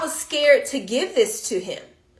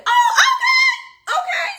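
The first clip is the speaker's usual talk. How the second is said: very high, slow squeal of surprise; shocked delight; 4 dB louder, slower than usual. Retold or imitated. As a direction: let it soar up in disbelief. Hearing son's high reading score.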